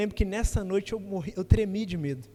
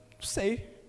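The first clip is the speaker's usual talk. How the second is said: hesitant; 5 dB quieter, slower than usual. Retold or imitated. Retold unsure answer as teenager.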